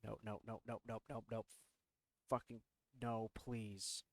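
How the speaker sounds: soft, low muttered nopes; quiet nope. Real, not acted. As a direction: mutter under your breath in dread. Dread at noise from closet.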